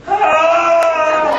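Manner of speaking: piercing high scream; sudden high-pitched shriek; demo scream. Retold or imitated. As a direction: let out a full anime battle scream. Retelling screaming in the booth.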